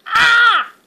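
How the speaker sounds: loud excited yell; playful shout. Real, not acted. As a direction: yell out in play. Child shouts in play.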